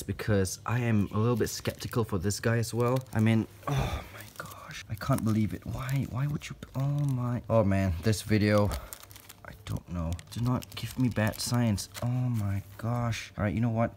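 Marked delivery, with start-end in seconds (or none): none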